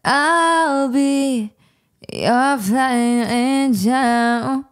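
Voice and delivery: high, slow and dreamy; dreamy singsong. Retold or imitated. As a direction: stretch it out sweetly. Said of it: "And I said".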